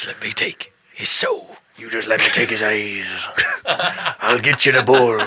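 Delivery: a menacing hunter murmuring; creepy hunter. Retold or imitated. Creepy hunter bargaining for the bull. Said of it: "some kind of creepy".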